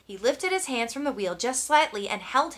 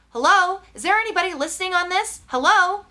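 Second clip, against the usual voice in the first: calling out hopefully into a mic; loud calling; 8 semitones higher, 7 dB louder than usual. Acted. Brian calling into the dead radio, loud and hopeful. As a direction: raise the voice, hoping someone answers.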